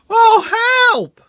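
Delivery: very high, loud frightened squeak; Percy squeaking at the flaming pudding. A narrator's reading. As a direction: squeal in panic. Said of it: "squeaked Percy".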